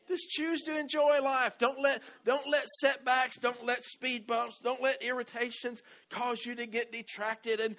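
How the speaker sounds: high, loud exhortation; fired-up preaching. Acted. Pastor urging the congregation, preaching loud. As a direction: urge the church on with full force.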